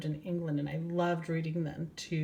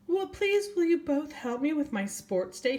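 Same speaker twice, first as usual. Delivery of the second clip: much higher, pleading little owl voice; polite owl asking; 10 semitones higher, 4 dB louder than usual. Acted. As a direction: ask sweetly for help. Ernest Owl asks for help.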